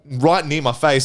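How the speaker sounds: ordinary delivery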